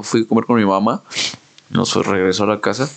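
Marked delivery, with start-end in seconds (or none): none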